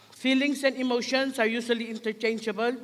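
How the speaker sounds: ordinary delivery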